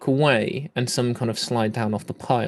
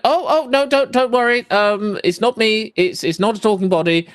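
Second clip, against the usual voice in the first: flustered mage; 4 semitones higher than usual. Acted. Hiding mage reassures the party it is not the corpse, flustered. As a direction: talk fast to calm the startled party. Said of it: "And he went".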